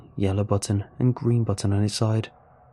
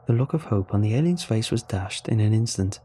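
plain reading voice

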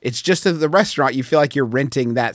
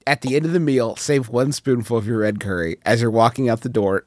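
ordinary talk

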